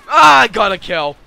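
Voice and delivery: loud, high burst of triumph; elated shout. Real, not acted. Player finally gets a kill, elated shout.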